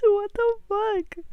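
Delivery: real exasperated wail through tears; tearful cry. Real, not acted. Gamer crying at the game, wailing in real frustration. Sobbing gamer stuck on puzzle.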